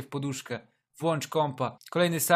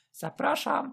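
high-pitched flirty woman; 10 semitones higher, slower than usual. Acted. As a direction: invite him in sweetly. Escort invites him in.

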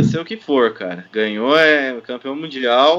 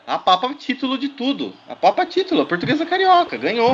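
empolgado; 9 semitones higher, faster than usual. Real, not acted. Host hypes the champion team.

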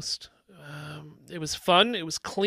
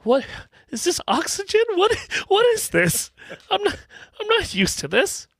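high, amazed little voice; voiced sinuses; 12 semitones higher than usual. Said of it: "my sinuses were like".

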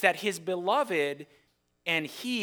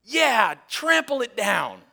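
much higher, much louder mock cheer; crowd cheering; 7 semitones higher, 9 dB louder, slower than usual. The crowd cheers the vineyard owner's threats, loud mock cheer. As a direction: egg him on like a rowdy crowd. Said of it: "I can imagine the crowd going".

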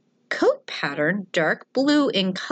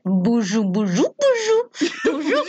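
repeated bonjour getting louder and higher; mock French bonjour, louder each time; 4 semitones higher, 3 dB louder than usual. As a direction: tease her with a rising, hammed-up bonjour. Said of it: "I would go to her and go".

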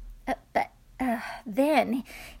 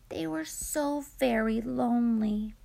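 usual speaking voice

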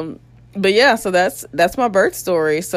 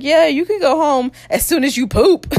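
high, chirpy imitation; mock cheery staff; 9 semitones higher, 3 dB louder than usual. Staff telling her when she can leave, mock cheer. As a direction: announce it in a raised mock-helpful pitch. Retold staff discharge condition.

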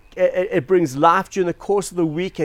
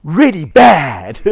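loud, slow mock verdict; hammed-up scolding, stretching both words; disapproving doctor; 8 dB louder, much slower than usual. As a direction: deliver a drawn-out doctor's condemnation. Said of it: "He goes".

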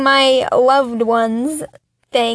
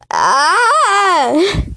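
long exasperated, higher "aah"; frustrated cry; 5 semitones higher than usual. Real, not acted. Podcaster fed up with the nominee, crying out in exasperation. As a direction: let out a drawn-out cry of frustration. Exasperated end of political rant.

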